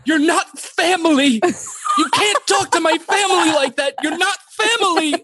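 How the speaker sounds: very high shrill mock yell; dramatized Megan. Brother mocking his sister yelling at the salesman. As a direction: scream it like a hysterical sister. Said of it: "she goes".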